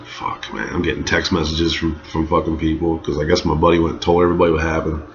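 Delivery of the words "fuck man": groaning regret. Retold or imitated. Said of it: "laying in bed like".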